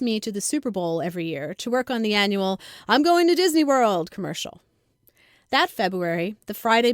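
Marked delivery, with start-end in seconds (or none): 2.9-4.1 bright, louder, higher ad-announcer read; mock announcer slogan. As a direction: sell it like the famous commercial tagline. Famous ad slogan quoted.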